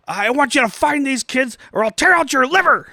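mock-threaten like a movie villain; Terry Silver yelling. Friend imitating villain Terry Silver yelling at his men. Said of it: "I can't even do his delivery".

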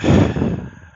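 long heavy exhale; withering sigh. Real, not acted. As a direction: sigh out loud, weary and heavy. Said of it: "That was a heavy sigh".

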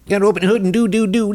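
high-pitched Southern babble, like Ross Perot on speed; Doug Collins. Retold or imitated. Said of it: "Ross Perot on Speed".

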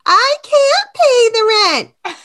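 high, loud wailing damsel; damsel in distress. Acted. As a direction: wail it like a helpless heroine. Damsel line, second take.

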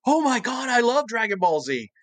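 high-pitched, thrilled fan outburst; imitated fan gushing. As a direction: gush like a delighted fan you're imitating.

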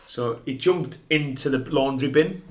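ordinary talk, nothing marked

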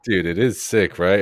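ordinary talk, nothing marked

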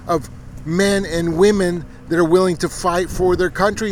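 high, loud pulpit preaching pushed hard; fiery preaching. Acted. Preacher rallying the nation to save America, full-force preaching.

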